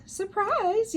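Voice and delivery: very high, loud surprise shout; yelled. A narrator's reading. The Riveters springing the surprise on June, yelling. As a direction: shout it to catch June off guard. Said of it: "yelled the Riveters".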